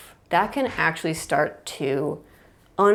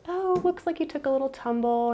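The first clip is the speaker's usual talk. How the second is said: high, soft soothing voice; calm caregiver; 9 semitones higher, 3 dB quieter than usual. Retold or imitated. Caregiver calmly comforting a toddler who tumbled, soft and high. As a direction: gently reassure the little one. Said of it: "is calm but present".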